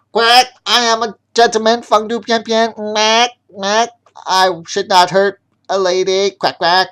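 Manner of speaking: high, loud quacking duck voice; a panicked duck gentleman; duck screaming. Acted. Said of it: "Ya-ya screamed to himself".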